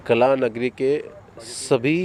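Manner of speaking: ordinary talk, nothing marked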